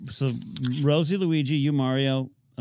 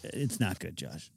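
glum; 6 semitones lower, 9 dB quieter than usual. Real, not acted. Admitting weigh-in bad.

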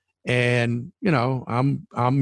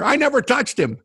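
mocking denial; 11 semitones higher than usual.

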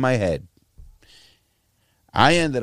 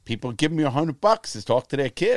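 ordinary delivery

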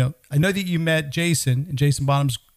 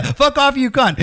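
gleeful quote; 8 semitones higher, 3 dB louder than usual. Retold or imitated.

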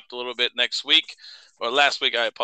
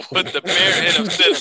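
reading through laughter, higher; laughing; 4 semitones higher than usual. Real, not acted. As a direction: try to read it while cracking up. Reading copy while cracking up.

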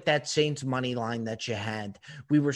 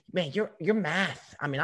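normal talking voice

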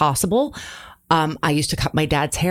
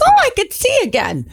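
high squeaky dad; 14 semitones higher, 4 dB louder, faster than usual. Retold or imitated. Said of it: "he'd be like".

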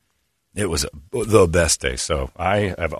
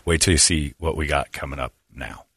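cop's spooky low hush; 6 semitones lower, 6 dB quieter than usual. Retold or imitated. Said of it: "And he goes".

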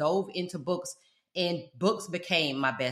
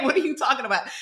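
laughing disbelief; 7 semitones higher, 4 dB louder, faster than usual. Retold or imitated. Retelling her reaction to the teasing, laughing through it. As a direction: question spoken through a laugh.

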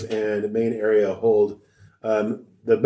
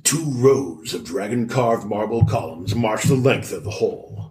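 higher, measured storyteller read; read-aloud narration; 4 semitones higher than usual. Acted. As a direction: read the scene like boxed text. Reads the boxed read-aloud text.

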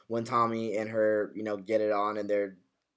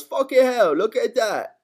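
much higher, louder mimicked exclamation; Alfie; 10 semitones higher, 6 dB louder than usual. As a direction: exclaim it high, imitating a TV character. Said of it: "that's when Alfie goes".

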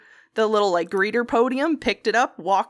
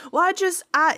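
higher, halting nervous excuse; stuttering nervous voice; 7 semitones higher than usual. Retold or imitated. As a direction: stammer it out nervously. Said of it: "he started stuttering".

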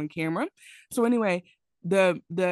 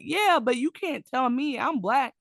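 imitating an offended anchor; indignant; 8 semitones higher, 4 dB louder than usual. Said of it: "He's like".

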